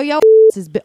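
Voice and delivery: word cut by a censor bleep; censored mid-word. Real, not acted. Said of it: "You just got bleeped".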